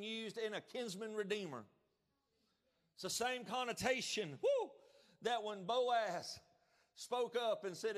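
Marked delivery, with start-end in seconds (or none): 4.4-4.7 sudden joyful woo; high excited whoop. Real, not acted. Preacher whooping mid-sentence to the congregation. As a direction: yelp with delight.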